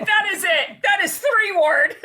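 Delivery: high, loud, laughing exclamation; drawn-out high cry through laughter; laughing mockery. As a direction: crack up while mocking, high and loud. Laughing hard, mocking the Frenchman.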